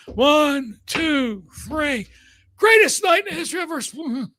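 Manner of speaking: high sing-song referee count; mocking impression. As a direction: count the pin in a silly high voice. Shivani mocked as referee.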